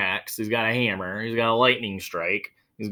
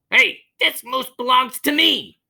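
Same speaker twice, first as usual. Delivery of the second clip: higher, slow, firm claim; Wilfred insisting; 13 semitones higher, 5 dB louder than usual. Acted. Wilfred correcting the old lady, high and insistent. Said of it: "he explained".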